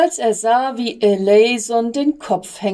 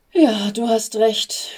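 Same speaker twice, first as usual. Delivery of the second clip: tired, voiceless whisper; exhausted whisper. Acted. Sick angel agrees before bed, whispered and exhausted. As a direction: barely breathe the words out. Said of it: "sagte Eleison erschöpft".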